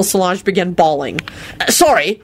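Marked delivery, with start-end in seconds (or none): 1.6-2.2 quick. A narrator's reading. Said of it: "he said quickly".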